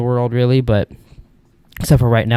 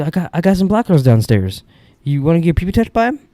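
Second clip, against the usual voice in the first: higher, put-on roommate voice; roommate impression; 6 semitones higher than usual. Roommate pushing Jerome to come downstairs, higher put-on voice. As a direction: play the eager roommate, pitched up. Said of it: "And he goes".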